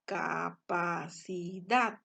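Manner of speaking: capacidad slowly in syllables, DAD loudest; stress on last syllable. Shown as a demonstration. Teacher splitting a word into four syllables, slow capacidad with stressed DAD. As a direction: stretch out capacidad, punching the final DAD. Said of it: "está la mayor intensidad de voz".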